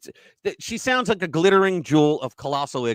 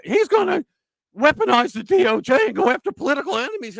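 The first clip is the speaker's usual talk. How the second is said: much higher and louder, a shrieking mock of a panicked convention speaker; mocking the DNC woman; 9 semitones higher, 6 dB louder than usual. Host mimics a DNC convention speaker, much higher, loud shrieking mockery. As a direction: screech her warning like a hysterical delegate. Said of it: "I'm doing that".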